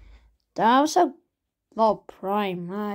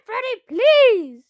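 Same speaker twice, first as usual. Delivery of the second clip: pleading shout; 9 semitones higher, 5 dB louder, slower than usual. Acted. Begging Freddy loudly.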